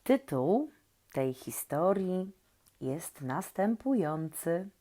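ordinary delivery

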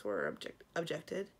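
plain reading voice

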